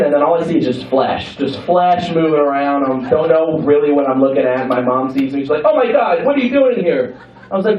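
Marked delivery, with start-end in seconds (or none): 5.6-7.1 high, panicked mom act; acted high-pitched alarm; startled mom. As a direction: exclaim it as a parent caught in the act. Startled mom caught by her child.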